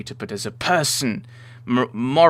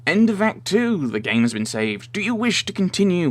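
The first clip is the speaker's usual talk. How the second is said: game announcer; 8 semitones higher than usual. Acted. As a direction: announce the save screen with showy swoops. Reads game's save screen.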